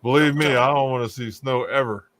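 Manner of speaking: normal talking voice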